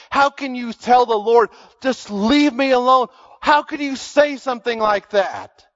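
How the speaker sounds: high, loud disbelief; incredulous. Real, not acted. Preacher to congregation, high loud incredulous exclamation. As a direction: raise the voice in shocked wonder.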